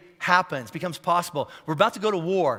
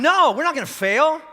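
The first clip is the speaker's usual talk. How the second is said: loud, higher, determined outburst; mad declaration; 8 semitones higher, 6 dB louder than usual. Retold or imitated. Pastor in his truck, loudly vowing not to fail. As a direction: shout down your own doubt. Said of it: "I got mad".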